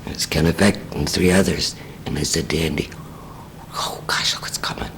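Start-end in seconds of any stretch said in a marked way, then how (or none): 3.7-4.9 whispered under the breath, much softer; hushed aside. Retold or imitated. Wife whispering to Andy as the car pulls up, hushed. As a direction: lean in and whisper the warning.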